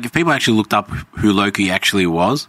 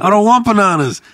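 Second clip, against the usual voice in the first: mock whiny refusal; 11 semitones higher, 5 dB louder than usual.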